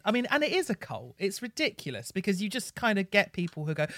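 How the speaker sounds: normal talking voice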